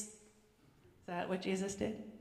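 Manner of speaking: ordinary talk, nothing marked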